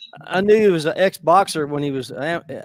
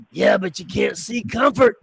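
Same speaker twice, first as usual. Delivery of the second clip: impression of Mister Brown; 4 semitones higher, 4 dB louder than usual. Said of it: "Do your impression".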